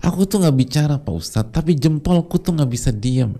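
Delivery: mock whiny congregant. Preacher mimics a congregant excusing her thumb, mock whiny. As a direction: play a congregant wriggling out of blame.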